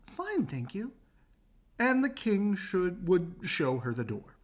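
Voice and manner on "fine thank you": polite princess. Acted. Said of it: "would politely say".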